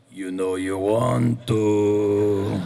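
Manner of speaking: very slow, drawn-out coaxing; tempting Andre voice. Retold or imitated. Andre the Giant coaxing the actor on the fourth day, slow and tempting. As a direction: stretch it out like a giant tempting you. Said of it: "he came up to me and he goes".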